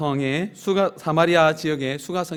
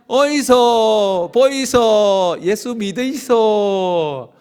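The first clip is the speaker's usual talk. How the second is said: very slow, louder Busan-dialect shout, 믿으이소 falling; loud Busan market call; 5 semitones higher, 10 dB louder, much slower than usual. Shown as a demonstration. Pastor leading the congregation's second round, loud Busan call. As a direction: belt it like a hawker across the market. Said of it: "한번 더 합시다".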